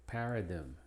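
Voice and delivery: ordinary talk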